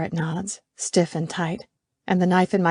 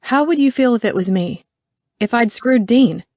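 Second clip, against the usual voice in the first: angry challenge; 5 semitones higher, 5 dB louder than usual. Acted.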